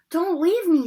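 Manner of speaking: frightened, loud plea; scared boy begging; pleading Neville. Acted. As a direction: beg them not to go. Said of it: "scrambling to his feet".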